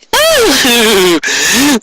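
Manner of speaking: high wicked cackle; evil laugh. Acted. Soysite cackling over Ami, high evil cackle. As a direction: cackle in triumph.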